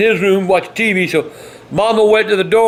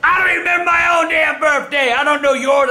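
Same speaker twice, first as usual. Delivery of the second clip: furious rant; 7 semitones higher than usual. Real, not acted. Grandfather ranting about the forgotten birthday, furious high shouting. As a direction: rant hard at the family.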